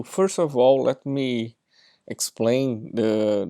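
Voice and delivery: usual speaking voice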